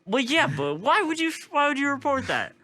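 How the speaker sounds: very high, excited squeal; high squeaky voice. Acted. Mock outraged defense of jetpack guy.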